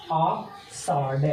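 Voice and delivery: Ashadh said slowly and drawn out; slow drawn-out repeat. Shown as a demonstration. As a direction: stretch Ashadh out very slowly, syllable by syllable. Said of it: "teacher repeats the month name".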